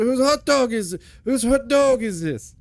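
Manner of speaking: hammed-up mobster impression of Seagal; Seagal as Italian mobster. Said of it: "start talking like an Italian mobster".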